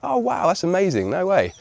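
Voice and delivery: high-pitched retold astonishment; amazed. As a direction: imitate a delighted captain who can't believe it. Captain amazed to meet a Brit.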